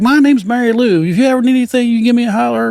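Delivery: put-on high, loud sweet old lady; imitating the Southern store lady. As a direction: chirp it like a friendly old store clerk. Old lady voiced in story.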